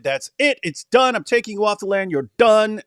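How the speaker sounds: lightly acted final ruling; mock divine verdict. Voicing Allah's verdict in a retelling.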